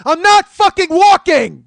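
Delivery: very high, slow full-force scream; explode, stretching every word at top volume; enraged scream. Acted. Comedian turning on the nagging woman, enraged scream. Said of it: "convey to you the rage I felt".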